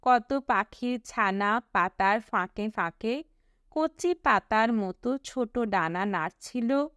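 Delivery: usual speaking voice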